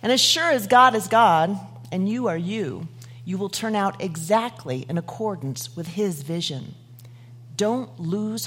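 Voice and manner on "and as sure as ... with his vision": ordinary talk, nothing marked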